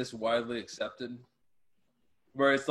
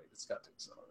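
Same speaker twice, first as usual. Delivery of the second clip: whisper; 17 dB quieter, much faster than usual. Acted. Whispered repeat of line 196.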